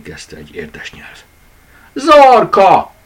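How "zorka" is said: much higher, loud startled call; a child catching the bunny in the act; crying out. A narrator's reading. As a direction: shout the name in surprise. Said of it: "kiáltott fel".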